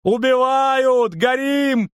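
high, drawn-out howled shout; panicked howling cry; wailing alarm. A narrator's reading. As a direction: wail for help at the top of your range. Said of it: "завыл он, влетая в общий зал".

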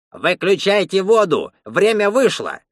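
put-on high old man's call through a wall. A narrator's reading. Attendant to the bathers behind the wall, calling out. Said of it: "крикнул из-за стены дед".

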